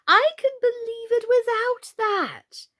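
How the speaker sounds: high, slow dismissive queen; haughty queen. Acted. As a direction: dismiss it loftily.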